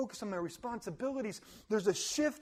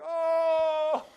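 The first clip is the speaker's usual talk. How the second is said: loud, high, slow woe-is-me wail; mock self-pity at his own pity party; mock lament; 7 semitones higher, 7 dB louder, much slower than usual. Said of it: "throw a pity party".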